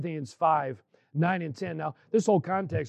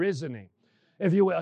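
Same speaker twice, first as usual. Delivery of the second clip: usual speaking voice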